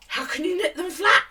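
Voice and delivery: high, loud disbelieving question; mock appalled at the method; retold incredulous outburst. Knitter questioning woman who knits cuffs flat, incredulous.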